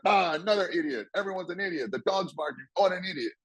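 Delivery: imitating a sour, complaining boss; mock grumbling. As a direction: moan like nobody can do anything right. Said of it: "If you're bitching and moaning".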